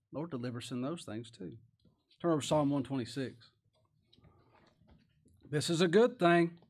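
normal talking voice

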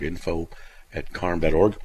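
ordinary talk, nothing marked

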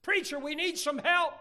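high, loud pleading shout; acted urgent outcry; cry for help. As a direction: call desperately to the preacher. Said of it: "They were saying".

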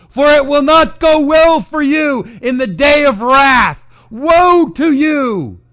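shouted high and loud woes; Jesus condemning unbelievers, thundering woes; preached woe. Acted. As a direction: thunder each woe like a prophet. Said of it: "So how does Jesus evangelize?".

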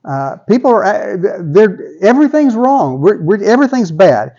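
high-pitched, worked-up grumbling to God; complaining prophet. Acted. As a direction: act out an exasperated complaint. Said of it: "He's complaining".

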